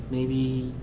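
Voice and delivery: ordinary talk, nothing marked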